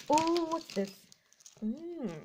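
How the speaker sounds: ordinary talk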